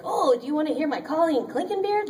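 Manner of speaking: high, singsong eager fan; eager impressionist. Mimicking a hopeful voice actor showing off, eager and high. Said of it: "Instead of being like".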